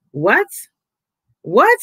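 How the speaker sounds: stunned double take; disbelieving what?!; shocked what. Retold or imitated. Shocked reaction to [name].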